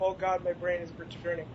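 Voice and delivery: normal talking voice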